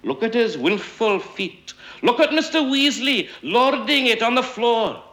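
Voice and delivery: high, far-pitched outraged exclamation; indignant cry. A narrator's reading. A member exclaims at Mister Weasley on the floor, indignant. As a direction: call out in mock outrage.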